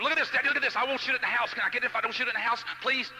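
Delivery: put-on high, loud, eager child begging; imitating a child's urgent wheedling; pleading kids. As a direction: beg Daddy like an excited kid. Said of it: "they got their little allowance".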